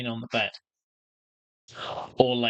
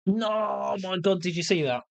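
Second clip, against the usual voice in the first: surprised; 7 semitones higher than usual. Real, not acted. Reacting to teammate's play.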